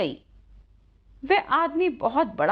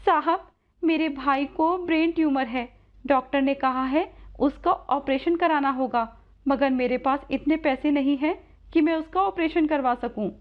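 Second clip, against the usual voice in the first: put-on higher, pleading girl's voice; distressed young Radha; 4 semitones higher than usual. Radha tells rich man of brother's tumour, higher pleading voice. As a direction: plead as a worried sister, pitched up.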